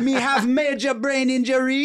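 high, slow, hammed-up fake Jamaican accent; mock a celebrity faking Jamaican patois; imitating a fake Jamaican accent. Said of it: "be like".

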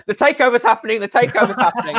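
high, loud excited yell; replaying a joyous shout of breaking news. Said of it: "I remember shouting at my mum and dad".

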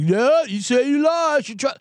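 high, slow sneering troll; mock commenter. Mimicking a commenter brushing off his picks, high and slow. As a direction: drag it out like a dismissive commenter. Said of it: "they'll be like".

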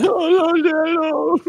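high, pleading mock sobbing; hammed-up crying boy; blubbering son. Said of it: "the blubbering".

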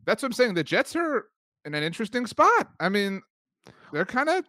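high, teasing cutesy tone; playful high voice. Real, not acted. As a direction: say it like something adorable.

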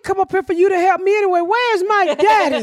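put-on high, fast sassy kid voice; sassy child. Child talking back to the woman who came to help, high and sassy. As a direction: pipe up high like an indignant little girl. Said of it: "She was like".

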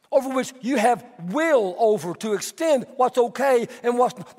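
normal talking voice

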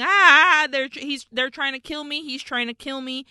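playful cry for help, scream then plead; retold scream. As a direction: imitate a screaming victim, mildly panicked. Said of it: "so now she's screaming".

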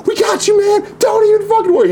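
very high, shouting reassurance; local rescuers. Retold or imitated. Local women diving in to save him, high shouted reassurance. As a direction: chirp it high like rescuing lifeguards. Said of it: "they were like".

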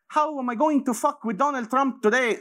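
high, put-on girlish voice; mock scheming girl. Mocking Greta as a scheming girl, high and girlish. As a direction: sneer it in a high girly voice. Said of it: "She's not sitting at home going".

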